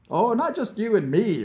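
high, lilting amusement; amused, pitched well up. Acted. Vlad correcting Nikita about the Siberia trip, amused.

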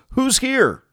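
high, startled call into the woods. Acted. Narcissus calling into the woods, high and startled. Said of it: "Narcissus turns around and says".

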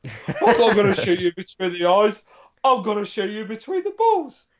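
hammed-up action-film villain; Bennett from Commando. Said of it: "it becomes Bennett from Commando".

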